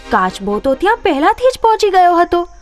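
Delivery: plain reading voice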